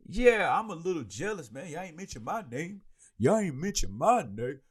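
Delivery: loose impression of a comedian, high swooping singsong; badly faked comic voice, playfully whining; Mike Epps. Host imitating a comedian jealous at being left out, hammed-up mock complaint. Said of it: "I don't have a Mike Epps impression".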